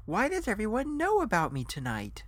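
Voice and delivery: high, incredulous question; exasperated bewilderment. Acted. Guest wonders how everyone knows about him, bewildered and high. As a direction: demand an answer, pitch leaping up.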